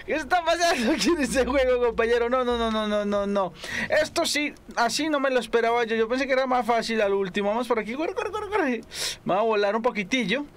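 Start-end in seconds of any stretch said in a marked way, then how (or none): 0.1-2.3 incredulous exclaim. Real, not acted. Sorpresa ante el juego. 7.9-8.8 urgent high cries. Real, not acted. Huye corriendo.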